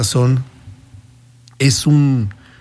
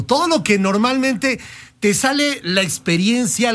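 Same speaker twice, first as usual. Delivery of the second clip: normal talking voice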